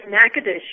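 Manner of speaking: Natchitoches firmly as NACK-uh-dish; correct local pronunciation. Shown as a demonstration. Tour guide confirming to the host, correct pronunciation. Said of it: "is pronounced Natchitoches".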